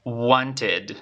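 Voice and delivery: "wanted" as WON-tid, for repeating; model pronunciation. Shown as a demonstration. Teacher asks students to repeat after her, careful model. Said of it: "Repite conmigo".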